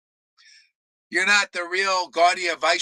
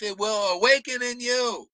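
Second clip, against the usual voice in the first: ordinary delivery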